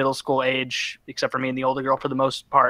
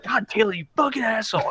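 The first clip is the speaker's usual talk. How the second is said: put-on higher annoyed kid voice; kids' complaint; 7 semitones higher than usual. Carpool kids scolding Taylor, higher annoyed whine. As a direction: whine at the driver.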